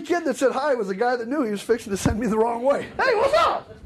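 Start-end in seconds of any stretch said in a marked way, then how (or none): 3.0-3.7 put-on high, laughing hey there from the kid; chipper kid. Kid who sent him the wrong way, high laughing greeting. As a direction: toss off a bright casual greeting.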